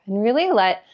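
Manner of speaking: calm meditation guide with long pauses; guided meditation. Acted. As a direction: ease listeners into their body, leaving space between phrases. Leads a guided meditation after a chatty intro.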